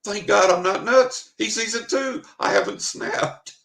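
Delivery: high, loud burst of relief; relieved outburst. Retold or imitated. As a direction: blurt it out, giddy with relief. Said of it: "And my reaction is".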